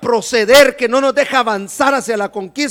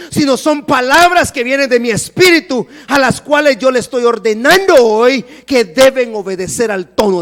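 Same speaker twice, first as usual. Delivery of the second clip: louder, driving sermon delivery; full-force preaching; 7 dB louder than usual. Acted. Preacher urges the church to command the mountain, loud. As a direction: preach it out with conviction.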